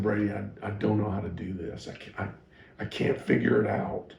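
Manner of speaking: mild tearful confession to his mentor; quietly reliving breaking down; retold tearful plea. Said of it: "I just started sobbing".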